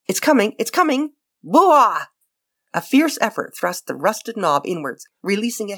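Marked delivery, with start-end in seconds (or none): 0.1-2.1 excited strain. Acted. Straining at the stiff knob.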